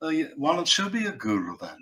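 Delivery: lightly imitated musician's voice; a hint of impersonation, casually suggesting. Musician friend making a suggestion, lightly imitated. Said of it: "[name] said to me".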